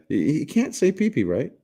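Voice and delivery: ordinary delivery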